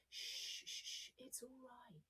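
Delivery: put-on whispered shush to calm a baby; soothing whisper. Shown as a demonstration. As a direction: hush a crying baby in a whisper. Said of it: "you say to a baby when it's upset".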